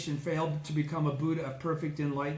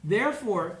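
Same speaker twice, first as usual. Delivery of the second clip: usual speaking voice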